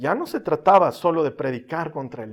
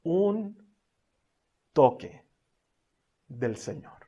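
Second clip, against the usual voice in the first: slow, spaced; 5 dB quieter, slower than usual. Real, not acted. Same phrase, slow, spaced.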